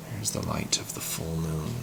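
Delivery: breathy hushed whisper of the line. Shown as a demonstration. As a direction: whisper it to set a spooky mood. Teacher whispers atmospheric line.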